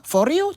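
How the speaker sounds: doubting for real, sharp rise; questioning for real. Shown as a demonstration. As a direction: ask it, surprised: for real?. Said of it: "Mais si c'est sous forme de question".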